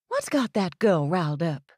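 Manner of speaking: a hint of a folksy older cook; curious grandmother asking about the girl; lightly voiced old woman. Cook asking the Englishman about Danny, mildly aged voice.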